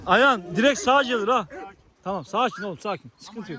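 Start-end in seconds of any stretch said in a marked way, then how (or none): 2.0-3.6 soft calming voice for a dog; soothing. Real, not acted. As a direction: settle the dog down gently. (köpeği sakinleştiren yumuşak ses)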